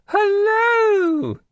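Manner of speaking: very high, loud regal hello; sing-song high royal greeting; queenly hello. A narrator's reading. Queen of England on phone.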